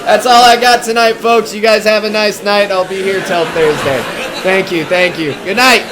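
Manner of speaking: loud, high comedian working the crowd; brash club comic playing to the room; stand-up stage act. Comic working a club crowd, brash and loud.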